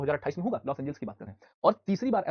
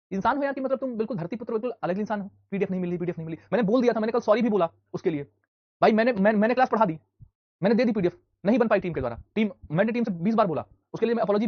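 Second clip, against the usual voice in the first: higher and louder, fed-up complaining; exasperated rant; 6 semitones higher, 5 dB louder than usual. Real, not acted. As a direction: vent at the students who only want the PDF. Teacher ranting about PDF demands. (झुंझलाकर ऊँची आवाज़ में शिकायत)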